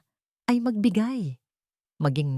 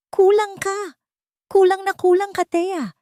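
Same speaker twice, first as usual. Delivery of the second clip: put-on much higher, louder shouting old woman; furious grandmother; 6 dB louder than usual. A narrator's reading. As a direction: hurl the insult at her. Grandmother berating Thea.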